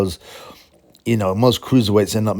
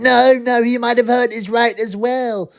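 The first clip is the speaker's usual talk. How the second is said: much higher, whiny mock voice; mocking whiny subscriber; 12 semitones higher than usual. Vlogger mocks ex-subscriber who won't concede: high whiny mock voice. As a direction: squeak it out like a stubborn brat. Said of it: "he still wouldn't have it".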